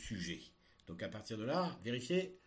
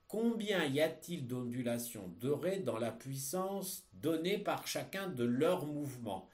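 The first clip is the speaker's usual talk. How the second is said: ordinary delivery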